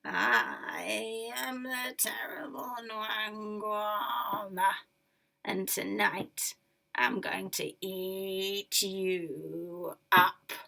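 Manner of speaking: slow, menacing monster voice; terrible monster. Acted. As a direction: growl it out very slowly. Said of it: "And then the creature spoke".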